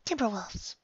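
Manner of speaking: high, soft fearful; timid. Acted. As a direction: breathe it out scared. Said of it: "said timidly".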